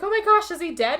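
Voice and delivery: high-pitched worried question; mock alarm. Retold woman finding the body.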